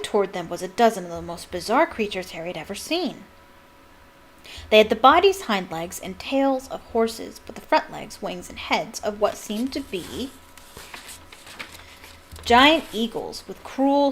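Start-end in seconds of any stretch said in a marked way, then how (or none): none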